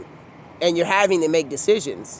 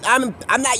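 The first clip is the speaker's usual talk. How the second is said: usual speaking voice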